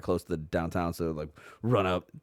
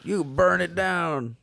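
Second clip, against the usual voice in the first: mock mob voice; 10 semitones higher, slower than usual. Mocking mob voice, slow far.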